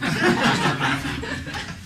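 a quick unvoiced laugh; breathy laugh. Real, not acted. Telling friends about his teen years, laughing. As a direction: laugh at your own story.

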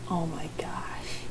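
slow, voiceless whisper; whispered dismay. Real, not acted. Streamer reacting to her own mishearing, whispered dismay. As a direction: let it out slowly under your breath.

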